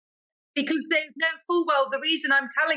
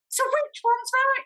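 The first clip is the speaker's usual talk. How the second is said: exasperated question; 12 semitones higher than usual. Retold or imitated. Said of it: "And I'm like".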